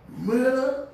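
sudden loud high disbelief; shocked outburst. Retold or imitated. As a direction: blurt it out, stunned. Shock at the news.

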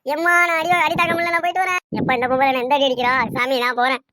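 high, loud comic wail of defeat; pained wailing. Acted. Boy fleeing the girl's beating, high loud wailing. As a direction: yelp and plead to get away. Boy beaten by girl, flees.

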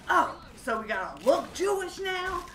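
high-pitched, offended challenge; indignant retold comeback; mock indignation. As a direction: throw it back in feigned outrage.

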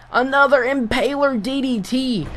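excited call that breaks up high; voice cracking. Real, not acted. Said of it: "I mean, my voice just cracked".

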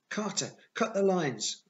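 high, loud, drawn-out panicked cry; urgent scream. A narrator's reading. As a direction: scream an emergency order to a companion. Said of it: "I screamed".

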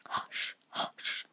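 soft whispered shushing; hushed warning; low and hurried. A narrator's reading. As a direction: shush urgently under the breath. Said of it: "in a low, hurried tone".

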